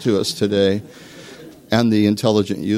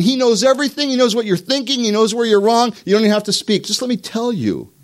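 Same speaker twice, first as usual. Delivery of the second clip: know-it-all; 11 semitones higher, 3 dB louder than usual. Retold or imitated. Preacher mocks a know-it-all.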